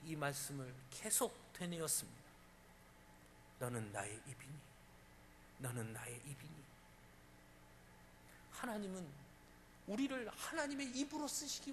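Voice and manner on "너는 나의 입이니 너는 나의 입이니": low, soft and distant, as if from above; a quiet inner voice asking; hushed divine question. Acted. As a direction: let the words fall quietly, like a voice heard within. God's question repeated, voiced.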